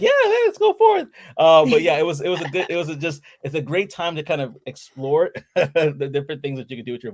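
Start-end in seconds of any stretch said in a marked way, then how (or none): 0.0-1.1 high, loud mock-eager reply; imitated enthusiasm. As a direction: act out an eager customer, pitched way up. Acting out enthusiastic customers' replies.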